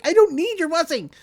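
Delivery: high, loud mock protest; indignant refusal. Student rejecting her admirer's blessing, indignant and high.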